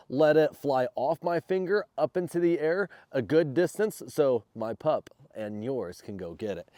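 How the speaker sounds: ordinary talk, nothing marked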